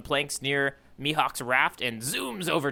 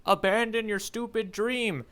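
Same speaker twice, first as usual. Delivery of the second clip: retold yell; 8 semitones higher, slower than usual. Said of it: "He even goes as far to yell out".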